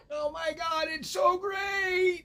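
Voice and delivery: very high, drawn-out mock squeal; over-the-top falsetto gushing; gushing excitement. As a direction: squeal it like an overexcited tourist, hammed up. Gushing re-enactment of London trip.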